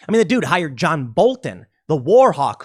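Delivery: normal talking voice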